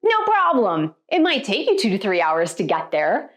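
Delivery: usual speaking voice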